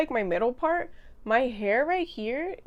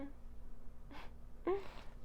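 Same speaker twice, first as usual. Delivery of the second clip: breathy laugh; 7 semitones higher, 14 dB quieter than usual. Real, not acted. Laughs at own bragging.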